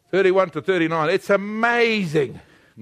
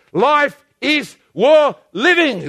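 slow, higher, heartfelt declaration; like you mean it; 5 semitones higher, 4 dB louder, much slower than usual. Shown as a demonstration. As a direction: proclaim it with full conviction, drawn out. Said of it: "say it like you mean it".

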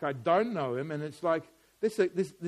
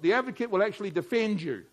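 ordinary delivery

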